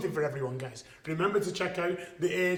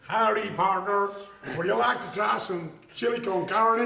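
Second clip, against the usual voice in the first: put-on Texas cowboy accent. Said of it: "my Texas cowboy accent".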